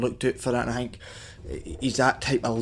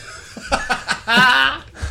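big laugh; 11 semitones higher, 4 dB louder than usual. Real, not acted. Teasing about upstairs.

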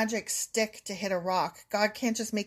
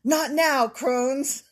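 louder, higher ad-lady line; commercial actress; 4 semitones higher, 5 dB louder, much slower than usual. Retold or imitated. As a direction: deliver it like a TV ad. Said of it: "that one commercial for Crohn's".

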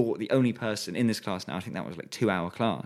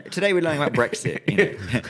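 laughing mock teacher; 8 semitones higher, 7 dB louder than usual. Joking mock lesson while laughing.

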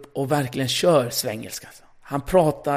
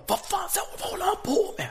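high squeaky mock gasp of disbelief; mock high-pitched bewildered whisper; shocked audience; 4 dB quieter than usual. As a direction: play the baffled crowd in a tiny high voice. Audience reaction imitated, squeaky high.

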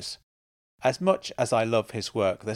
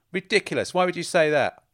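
louder, higher defensive protest; indignant; 7 semitones higher, 6 dB louder than usual. Acted. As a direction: bristle and push back hard. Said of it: "Just listen to your voice".

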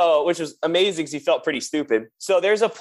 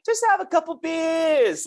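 very high, drawn-out put-on voice; joking crew impression; 13 semitones higher, slower than usual. Drummer imitating two techs' running joke, high drawn-out voice. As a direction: stretch it out in a silly high voice.

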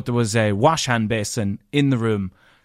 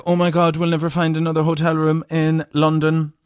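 high, hammed-up worry; mock panic; 5 semitones higher than usual. Two friends fearing they'll find no other London room, mock panic. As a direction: play up the panic in a higher voice. Acts out their panic over rooms.

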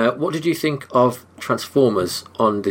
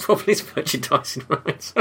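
laughing through the words; 6 semitones higher than usual. Real, not acted. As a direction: giggle while saying it. Laughing at the joke.

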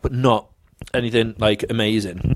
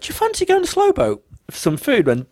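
sweetly innocent; 14 semitones higher than usual. Retold or imitated. Sweet call to his mum.

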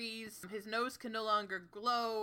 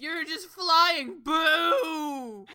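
booing crowd; 5 semitones higher, 6 dB louder, much slower than usual. Retold or imitated. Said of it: "booing audience".

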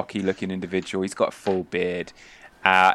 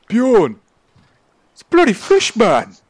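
high, loud holler to a shipmate; alarmed shout; 13 semitones higher, 9 dB louder than usual. Acted. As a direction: yell the astonishing news. Sailor overheard yelling to Bjorn.

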